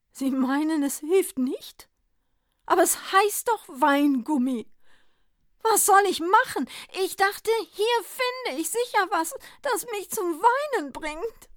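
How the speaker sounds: high, loud, pleading Santa; bewildered Santa Claus. A narrator's reading. Santa begging the druggist, high and desperate. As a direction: Santa baffled and rising to despair.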